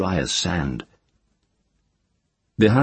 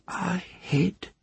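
faint whisper; 12 semitones higher, 8 dB quieter than usual. Acted. The child's held faint whisper.

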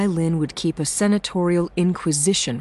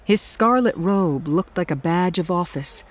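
usual speaking voice

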